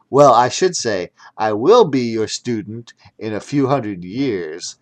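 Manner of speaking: usual speaking voice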